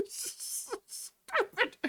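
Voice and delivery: breathless laugh into a whispered "Stupid"; laughing insult. Real, not acted. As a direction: laugh it off, then spit the word out. Laughing insult after outburst.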